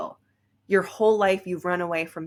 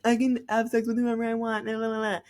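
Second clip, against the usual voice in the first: higher, sing-song and breezy, a hint of mockery; lightly hammed flippant voice; mock fake empowerment; 5 semitones higher than usual. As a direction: parody a carefree fake bravado. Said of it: "Not a fake empowered".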